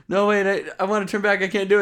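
high, loud pleading; hammed-up fear of going out; girl begging to turn back; mock panic. Voicing scared girl.

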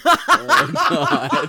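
high, loud laughing gasp; laughing exclaim. Real, not acted. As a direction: burst out laughing.

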